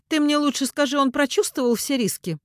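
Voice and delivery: high, loud pressing woman; insistent demand. A narrator's reading. Mother asks if son felt the risks, insistent. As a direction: press hard for an answer.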